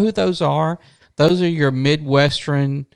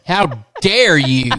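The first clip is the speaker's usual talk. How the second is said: hammed-up outrage, drawn out; slow, theatrical affront; mock indignation; 3 dB louder, much slower than usual. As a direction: play offended, stretching the words. Jokingly acts out taking offence.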